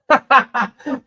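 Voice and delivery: short, loud high laugh. Real, not acted. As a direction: burst out laughing briefly. Amused during livestream chat.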